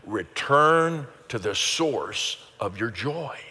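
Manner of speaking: slow and deliberate, every word spaced out; slow group recitation. Shown as a demonstration. Pastor guiding the church to repeat together, slowly. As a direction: lead the congregation through it word by word. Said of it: "So say that with me".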